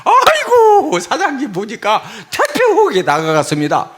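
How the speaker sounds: high, loud swooping "aigo" of flattery; mock-gushing agreement with a customer; fawning hostess. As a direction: play a hostess overdoing her admiration. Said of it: "막 동의를 해줘".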